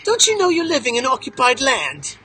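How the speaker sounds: British-sounding mock Australian, slightly lifted; badly faked Australian that comes out British; attempted Australian accent. Guide imitates an Australian journalist challenging him, bad Australian accent. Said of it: "I can't do Australian, so it sounds British".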